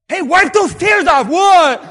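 loud, high and urgent; acted disciple snapping mourners out of grief; rousing urging. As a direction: call out sharply, pitch jumping. Disciple rouses the crying mourners.

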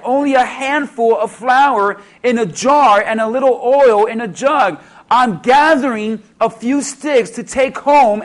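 loud, fervent preaching. Acted. Preacher retelling Elijah to the church, loud and fervent. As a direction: drive the story home to the crowd.

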